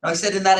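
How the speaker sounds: patient correction on "that"; stress on that. Shown as a demonstration. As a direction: firmly repeat "that exact moment". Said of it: "Did I say in every moment?".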